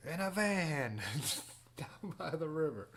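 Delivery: high, drawn-out comic impersonation with a laugh breaking in; Chris Farley. Acted. Host quoting a comedian to livestream viewers, hammed-up impersonation. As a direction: stretch it out high, as a bad celebrity impression. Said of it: "[name]'s Chris Farley impersonation".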